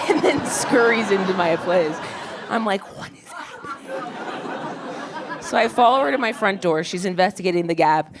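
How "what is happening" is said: slow whispered disbelief; baffled whisper. Retold or imitated. Comic narrator reacting to the landlady, slow baffled whisper. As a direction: breathe it out in quiet bewilderment.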